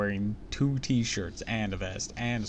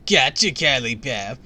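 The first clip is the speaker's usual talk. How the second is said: teasing shout; 3 semitones higher, 9 dB louder, slower than usual. Acted. Kid teasing after prank.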